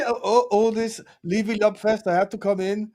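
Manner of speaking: slow, playful Eastern European accent impression; Eugene's accent. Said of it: "It was an accent. It wasn't Eugene's accent".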